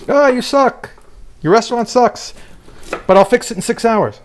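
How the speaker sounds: high, brash mock celebrity chef; mocking loud TV chef. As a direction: ham up the shouty TV chef. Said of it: "that English guy".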